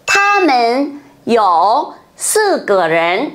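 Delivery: Tha men you sư ge ren said very slowly; slow model sentence. Shown as a demonstration. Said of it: "mình sẽ nói như nào đây nhỉ".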